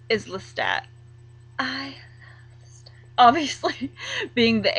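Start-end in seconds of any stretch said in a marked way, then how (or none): 1.6-2.9 breathy whispered confession; whispering. Real, not acted. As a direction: whisper it like a secret crush. Dreamy confession about favourite vampire.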